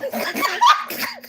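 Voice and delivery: high, shrieking burst of laughter; a real, helpless shriek of laughter. Real, not acted. Streamer cracking up at chat over Eric, shrieking laugh.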